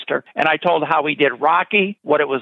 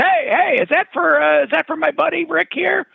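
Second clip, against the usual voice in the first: higher, breezy buddy-buddy voice; imitating Bruno Kirby; 10 semitones higher than usual. Bruno Kirby charming the delivery guy, high breezy voice. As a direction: play the friendly star charming the courier.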